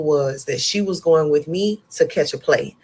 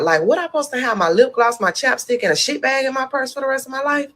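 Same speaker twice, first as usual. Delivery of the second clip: higher, hammed-up disbelief, faster at the end; higher mock outrage over the bag in her purse; retold panic; 9 semitones higher, faster than usual. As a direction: replay the freak-out for laughs. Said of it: "I was flipping out".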